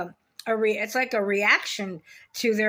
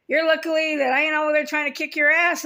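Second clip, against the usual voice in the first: raised, heated warning; angry threat; 5 semitones higher, 4 dB louder than usual. Real, not acted. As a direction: warn the sister off, pitched up. Threatens sister in direct address.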